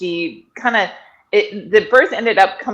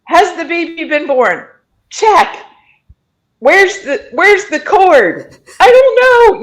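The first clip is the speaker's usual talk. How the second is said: high, loud mock panic reading a checklist; flustered paramedic; 10 semitones higher, 7 dB louder, slower than usual. Panicking paramedics reading their checklist aloud, high hammed-up fluster. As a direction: rattle through the list, losing it by the end. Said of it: "read through this list of things".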